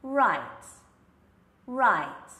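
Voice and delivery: "Right" loud, with the tongue curled back and the lips rounded; clear R. Shown as a demonstration. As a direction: curl the tongue back before saying "right". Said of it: "So it's like this, curved up".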